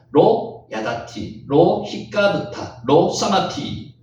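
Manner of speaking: "로 야다티, 로 히카드타, 로 사마티" slow and evenly metred; "로 야다티, 로 히카드타, 로 사마티" in slow parallel beats; rhythmic recitation. Shown as a demonstration. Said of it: "자, 다시 한번 읽을게요".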